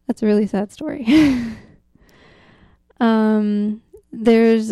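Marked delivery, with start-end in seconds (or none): none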